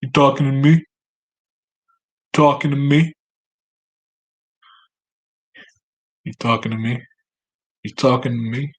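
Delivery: a lightly voiced tough guy from Taxi Driver; Taxi Driver quote. Vlogger quoting Taxi Driver at his cat, lightly mock tough. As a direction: repeat the line like the movie scene. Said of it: "It's a taxi driver".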